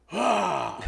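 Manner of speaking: loud, high imitated gasp of "Ah!"; storming-in yell. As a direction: burst in with a big noisy "Ah!". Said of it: "comes in like a storm".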